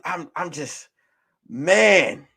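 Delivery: ordinary talk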